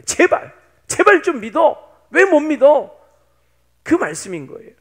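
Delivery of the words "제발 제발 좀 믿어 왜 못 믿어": loud, high, insistent pleading; impassioned preacher's demand; urgent exhortation. Acted. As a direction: implore them forcefully to believe. Preacher voices God urging belief.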